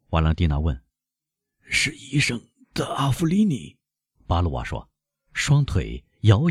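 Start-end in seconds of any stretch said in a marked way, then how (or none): 1.6-3.8 high, strained announcement; unsteady, higher-pitched reply; faltering servant. A narrator's reading. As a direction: announce the visitor as your strength fails. Stricken servant, legs shaking, announces visitor.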